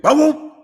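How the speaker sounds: loud, high mouth-made gavel slam; gavel bang imitation. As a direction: bang it out like a gavel hitting the bench. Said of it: "that judge said".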